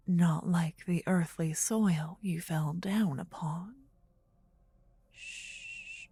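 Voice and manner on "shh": soft, long hushing; gentle shush. Acted. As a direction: shush them gently to calm. Hushing the listener's questions.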